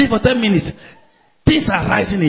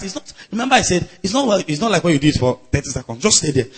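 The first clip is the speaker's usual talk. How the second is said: ordinary delivery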